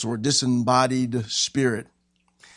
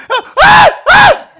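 high, very loud terrified screams; short shrieks of terror; cry of fear; 17 dB louder, slower than usual. Acted. Peter screaming at the ghost on the water, panicked shriek. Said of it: "they cried out for fear".

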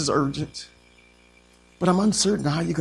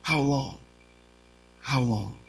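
fearful; 3 semitones lower, 6 dB quieter, slower than usual. Acted. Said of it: "some fear set in".

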